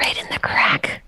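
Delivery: unvoiced teasing whisper. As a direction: breathe it out teasingly. Mock seductive banter.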